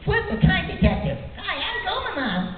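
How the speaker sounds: high-pitched cartoon creature impression; small, high cartoon character voice; Gomamon. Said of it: "he played Gomamon on Digimon".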